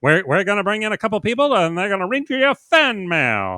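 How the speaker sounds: put-on lightly done old-time announcer voice; a hint of fast-talking forties showman; 1940s voice. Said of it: "He's doing that old time nineteen forties voice".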